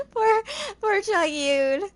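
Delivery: high, slow coo through giggles; laughing pity. Real, not acted. Reader feeling for poor Chong Yun, amused pity.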